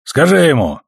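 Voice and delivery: captain's impatient order; barked order. A narrator's reading. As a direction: bark an order at a subordinate. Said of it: "рявкнул он".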